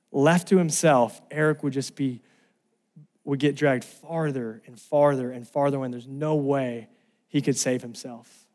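usual speaking voice

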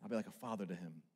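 soft fatherly promise; tender aside. Acted. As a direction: say it gently like a father. Tender quote of God.

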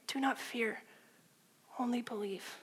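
soft, gentle, steady and firm; soft and close, calm conviction. Retold or imitated. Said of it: "so gentle and gracious and firm".

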